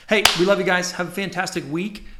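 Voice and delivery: ordinary talk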